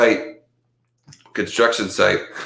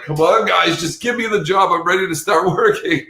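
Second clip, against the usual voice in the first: high, eager mock plea; pleading eagerly; 9 semitones higher than usual. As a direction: beg for the job, half laughing. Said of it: "I'm like".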